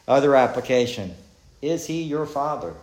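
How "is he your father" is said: ordinary talk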